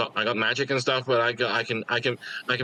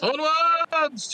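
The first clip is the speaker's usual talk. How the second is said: high, drawn-out call to set off; long high "onwards" holler; rallying cry. Acted. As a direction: call the party onward, pitched way up.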